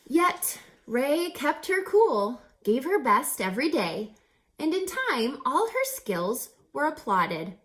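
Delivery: lively rhyming read-aloud with a bouncy lilt; storybook narration. A narrator's reading. Reader telling children a rhyming story, lively. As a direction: read it like a picture book to kids.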